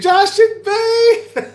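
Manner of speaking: squealed through laughter, very high and slow; laughing squeal. Real, not acted. Laughing squeal at viewer names.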